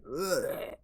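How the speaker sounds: imitated throwing-up groan; mimed retching. Fighter sick outside the ring, mimed retching. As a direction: fake a sudden heave. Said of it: "and she throws up".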